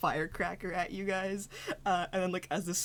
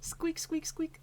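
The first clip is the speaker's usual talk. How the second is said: high little squeaks; high-pitched imitated squeaking; squeaking critter; 8 semitones higher, slower than usual. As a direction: squeak like a small animal. Game master voices small creature squeaking.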